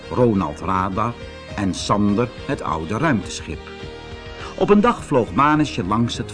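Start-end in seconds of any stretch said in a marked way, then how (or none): none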